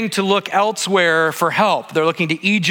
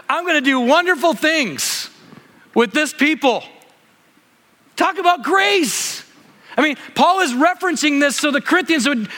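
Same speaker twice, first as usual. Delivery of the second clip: higher, urgent preaching with rising passion; fired-up preaching; 7 semitones higher than usual. Acted. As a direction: preach it out, lifting the room. Preaches at full force.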